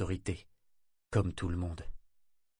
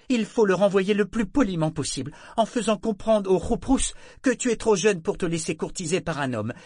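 scolding mother; 10 semitones higher, 5 dB louder than usual. A narrator's reading.

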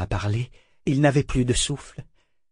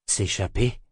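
understated, doubtful murmur; sceptical mutter; 3 semitones lower than usual. A narrator's reading. Brachen doubting the escape plan, muttering sceptically. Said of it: "marmonna-t-il sceptique".

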